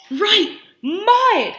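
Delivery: high, loud and slow "Blueberries!"; delighted teacher exclaiming; excited praise. Real, not acted. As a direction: cheer the answer like a reveal.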